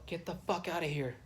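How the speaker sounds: usual speaking voice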